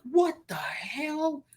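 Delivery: high, slow drawn-out disbelief; stunned disbelief. Retold or imitated. Seeing protesters block the airport road, slow stunned disbelief. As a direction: drag it out in shock. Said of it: "I'm like".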